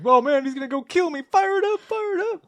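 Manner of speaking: very high, squealing mock panic; imitated screaming. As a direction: squeal it like a hammed-up doomed henchman. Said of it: "It's just screaming".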